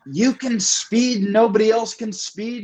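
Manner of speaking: high, drawn-out mock outrage; imitated freak-out. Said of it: "he starts freaking out".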